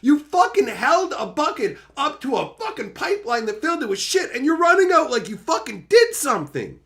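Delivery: high, loud indignant tirade; high-pitched furious telling-off; outraged rant. Acted. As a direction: yell it in exasperated outrage. Ranting at someone taking undeserved credit.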